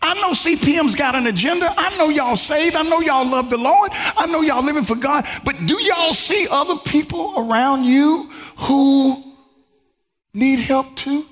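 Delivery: high-pitched, insistent plea; pleading cry. Retold or imitated. Outsiders crying out to church people for help, pleading. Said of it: "they're crying out and they're pleading".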